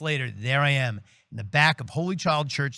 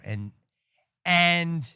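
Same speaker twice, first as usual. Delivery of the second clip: ordinary delivery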